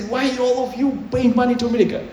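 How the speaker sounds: lightly voiced exasperated pitch to data centers; retold urging.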